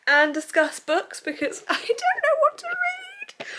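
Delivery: high, laughing exasperation; squeaky laugh of despair. Real, not acted. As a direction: laugh through the words at your own indecision. Laughs at own indecision.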